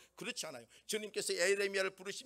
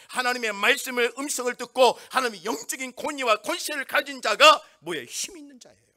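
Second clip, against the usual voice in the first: louder, driving sermon delivery; forceful preaching; 2 semitones higher, 12 dB louder than usual. Acted. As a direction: preach it hard to the congregation.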